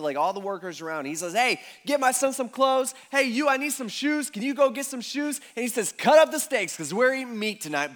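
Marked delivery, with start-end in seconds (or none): 1.3-5.4 higher and louder, calling out commands; barking orders. Retold or imitated. The father barks orders to the workers, loud and eager. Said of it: "starts barking orders". 5.9-7.9 put-on louder calls to the boys; barking orders. The father calls out to his workers, loud. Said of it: "starts barking orders".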